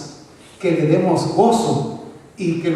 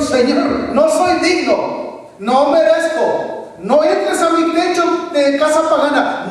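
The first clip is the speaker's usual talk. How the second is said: pleading centurion; 6 semitones higher than usual. Retold or imitated. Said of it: "como dijo el centurión".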